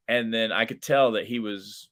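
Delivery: normal talking voice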